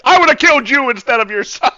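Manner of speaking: loud, fast threat; menacing threat. Acted. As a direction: spit out the threat. Paladin threatens the wife.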